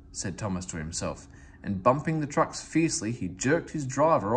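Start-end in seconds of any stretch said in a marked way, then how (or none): none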